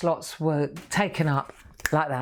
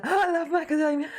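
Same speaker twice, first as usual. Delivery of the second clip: delighted gasp; 10 semitones higher than usual. Real, not acted. Gasping over favourite nut.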